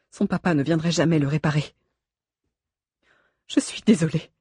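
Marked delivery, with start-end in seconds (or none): 3.5-4.3 apologetic. A narrator's reading. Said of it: "répétait Nanès".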